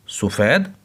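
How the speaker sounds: "सफ़ेद" said as su-fed, rising like a question; short u after the s in "सफ़ेद", asking; mispronunciation. Shown as a demonstration. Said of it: "तो सफ़ेद नहीं है". ("सफ़ेद" को सवाल की तरह सुफ़ेद बोलना)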